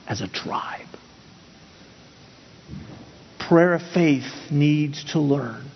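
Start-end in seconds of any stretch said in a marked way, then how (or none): 0.1-0.9 much lower, hushed whisper to end the point; dramatic whisper. Real, not acted. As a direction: let it fall to a soft whisper. Closing line of tribe point.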